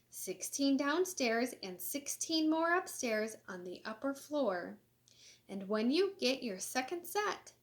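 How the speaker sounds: lively, sing-song storybook reading; animated picture-book read-aloud; reading to children. Acted. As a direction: read it bright and bouncy for kids. Reads clam, jellyfish, hen quotes.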